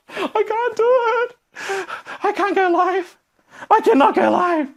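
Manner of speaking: high, slow whiny wail; hammed-up tearful refusal to go live; mock crying. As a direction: whimper it like a scared child. Acting frozen panic.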